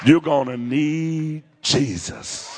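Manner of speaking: every word slowed and weighted; slow emphatic. Acted. Preacher warning the congregation, slow and weighty. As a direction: lay it down slowly and deliberately. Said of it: "preacher drives the point home".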